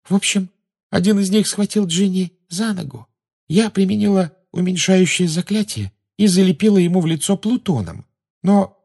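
put-on high-pitched girl's storytelling voice; dreamy girl Luna. A narrator's reading. Luna explains how she fought back, high-voiced. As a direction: explain it in a high girlish register.

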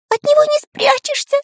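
squeaky, very high cartoon voice; tiny despairing squeal; Карандаш near tears. A narrator's reading. Small friend despairs of hiding, near tears. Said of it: "чуть не плакал Карандаш".

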